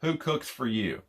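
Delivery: soft, slow owl hoots; owl hoot imitation. Shown as a demonstration. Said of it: "Remember that phrase".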